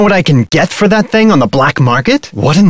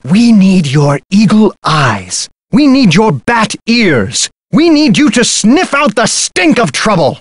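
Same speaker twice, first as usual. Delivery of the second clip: high, theatrical rallying appeal; impassioned plea; 6 semitones higher than usual. Acted. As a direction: plead grandly, building in pitch. Grandiose plea for the detective's help.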